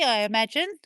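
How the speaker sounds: ordinary delivery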